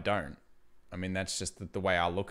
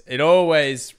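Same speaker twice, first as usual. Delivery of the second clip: much louder, higher punch on always; excited emphasis; 6 semitones higher, 14 dB louder than usual. Real, not acted. Streamer gushing to chat, loud excited always. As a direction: burst out the word always with joy.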